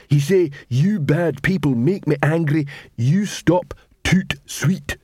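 troll voice. Acted. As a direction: quote Carrot with troll grammar.

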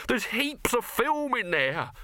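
high, drawn-out alarmed protest; slow, high-pitched outraged wail; snapped protest. A narrator's reading. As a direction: object indignantly, voice pitched right up. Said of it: "snapped Dibbler".

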